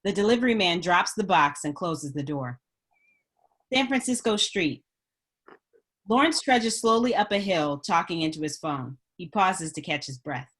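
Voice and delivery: normal talking voice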